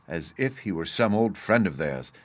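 usual speaking voice

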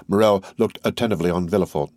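ordinary delivery

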